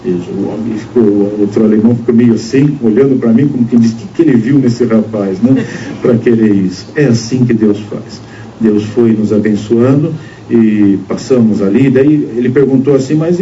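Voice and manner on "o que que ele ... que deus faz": normal talking voice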